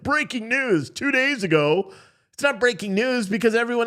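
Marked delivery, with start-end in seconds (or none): 0.0-1.9 slow, higher mock headline announcement; mock newsflash. Account on Twitter always posting breaking news, mock newsflash. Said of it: "account on Twitter that's always like".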